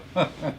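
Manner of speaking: brief, light laugh; a quick little chuckle to yourself; short chuckle. Real, not acted. Host to online class after sound check, short chuckle.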